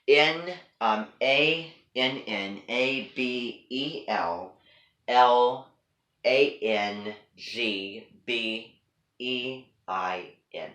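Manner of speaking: letter by letter, drawn out; slow, deliberate spelling with gaps between letters; spelled out slowly. Shown as a demonstration. Teacher spells a name for students to type, slowly spelled out.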